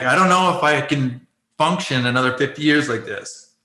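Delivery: ordinary talk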